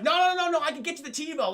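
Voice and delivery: high, loud burst of protest; mock urgent agent; Matthew McConaughey pleading. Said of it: "Matthew McConaughey's like".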